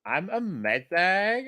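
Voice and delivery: put-on high, slow, bubbly valley girl drawl. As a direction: stretch it like a sorority girl. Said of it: "your best Valley Girl voice".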